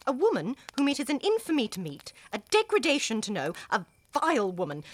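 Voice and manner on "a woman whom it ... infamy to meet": ordinary talk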